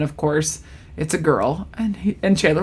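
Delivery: normal talking voice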